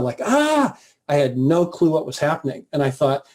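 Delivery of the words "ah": high-pitched mock yell of shock; imitating his own startled cry; retold yell. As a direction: yelp "ah" high, without much volume. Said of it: "I just yelled out loud".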